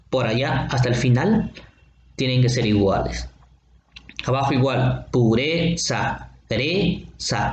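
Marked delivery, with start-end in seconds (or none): 5.1-7.5 "pureza" slowed, long "re" and a separate "za"; stressed-syllable demo. Shown as a demonstration. Teacher showing where the rhyme starts, "pureza" broken slowly after "re". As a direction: stretch the e in "pureza", then repeat its ending "reza". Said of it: "A partir de esta vocal acentuada".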